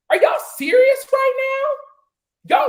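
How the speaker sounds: can't believe what she's hearing; shocked outburst at the other guests; disbelief. Real, not acted. Heated rant at panel.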